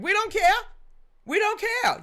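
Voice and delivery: high, loud, careless brush-off; we don't care, shouted high; mock dismissive yell. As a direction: toss it off loudly. Mocking the switchers.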